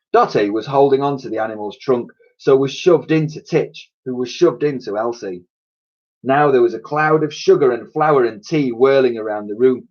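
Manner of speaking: ordinary delivery, nothing marked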